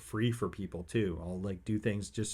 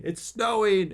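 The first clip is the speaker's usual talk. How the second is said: higher, louder mock announcement; retold exclamation; 10 semitones higher, 5 dB louder, slower than usual. Father quoting friends back in Montana, mock exclamation. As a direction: imitate friends exclaiming over news.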